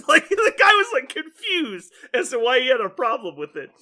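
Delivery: high, loud words breaking with laughter; giggly retelling; laughing. Real, not acted. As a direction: tell it while cracking up. Laughing while retelling story.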